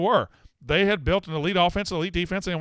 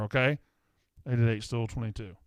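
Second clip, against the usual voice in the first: ordinary delivery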